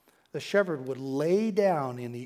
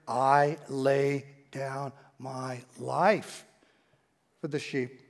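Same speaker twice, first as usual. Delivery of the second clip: slow, softer, solemn words; tender quote; 4 semitones lower, 4 dB quieter, much slower than usual. Acted. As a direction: lay it down gently and slowly. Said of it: "Jesus said".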